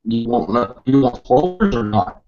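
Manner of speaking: ordinary talk, nothing marked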